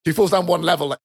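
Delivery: ordinary talk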